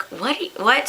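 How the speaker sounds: rising, baffled double question; mock disbelief, voice climbing on the repeat; retold astonishment. Friend describes her broken hair, answers incredulous.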